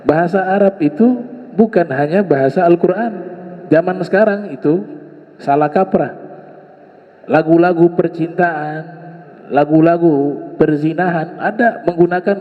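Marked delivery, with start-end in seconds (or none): none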